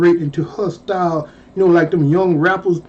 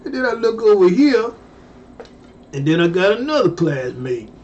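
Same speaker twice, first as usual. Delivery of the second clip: ordinary talk, nothing marked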